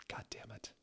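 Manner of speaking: whispered curse, very soft; exasperated aside; under breath. Real, not acted. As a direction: mutter it to yourself. Reader groans at the text.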